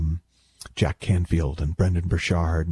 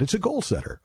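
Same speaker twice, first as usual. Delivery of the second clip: much higher mock proud owner; proud owner reply; 12 semitones higher, 3 dB quieter than usual. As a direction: answer like a proud dog owner, pitched up. Imagined dog-owner chat.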